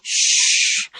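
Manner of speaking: a long unvoiced shh; hushing. Mother hushing crying baby.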